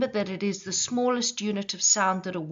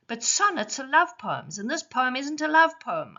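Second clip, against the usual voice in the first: higher, louder, indignant objection; mock student protest; 7 semitones higher, 3 dB louder than usual. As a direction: play the pupil who protests. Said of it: "I hear you cry".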